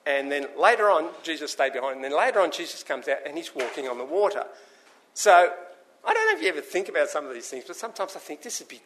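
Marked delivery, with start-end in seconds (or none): none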